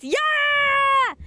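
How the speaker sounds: high loud cheer; excited shout. Real, not acted. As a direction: yell it out in triumph.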